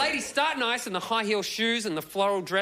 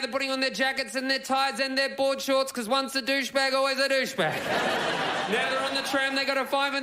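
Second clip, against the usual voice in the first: higher, building race-call commentary; race caller; 6 semitones higher than usual. Acted.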